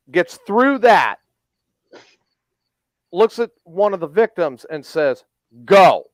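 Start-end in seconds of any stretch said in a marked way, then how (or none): 5.7-6.1 loud, one-word sergeant's command; short, forceful imitation of an order; retold order. Drill sergeant orders victim to talk.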